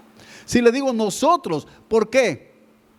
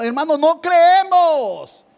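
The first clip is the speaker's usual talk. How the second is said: higher, louder and slow, NO CREEMOS hammered home; fervent outcry; 7 semitones higher, 4 dB louder, slower than usual. Real, not acted. Preacher rebuking his congregation, fervent slow outcry. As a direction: cry it out like a rebuke from the pulpit.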